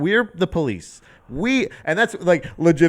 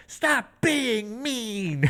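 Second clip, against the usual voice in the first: very slow, high, soft mock whine; drawn-out plea imitating a comedian; pleading comic; 6 semitones higher, 5 dB quieter, much slower than usual. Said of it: "where she's just like".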